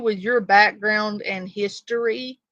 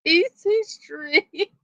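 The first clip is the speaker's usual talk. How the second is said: slow, high, crying with laughter; laughing; 11 semitones higher, slower than usual. Real, not acted. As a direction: stretch it out through tearful laughs. Repeats phrase mid laughing fit.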